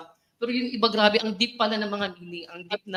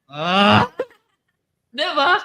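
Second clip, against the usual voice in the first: laughing; 6 semitones higher, 9 dB louder, much slower than usual. Real, not acted. Laughs at her own quote.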